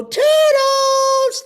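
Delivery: high cartoon call; Toodles voice. Shown as a demonstration. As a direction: chirp the name up high. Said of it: "I'm Toodles".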